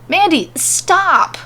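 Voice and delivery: slow, high-pitched shouted order; commanding shout. A narrator's reading. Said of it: "muster a commanding voice and shout".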